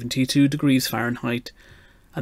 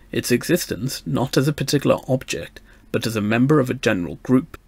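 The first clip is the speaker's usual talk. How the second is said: usual speaking voice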